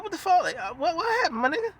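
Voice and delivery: high-pitched, baffled questioning; mock bewilderment. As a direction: play someone totally thrown by the uproar. Retold confusion after Davies wakes up.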